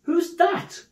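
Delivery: very high, startled question; surprised cry. Acted. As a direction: ask in utter astonishment. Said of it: "cried Fred".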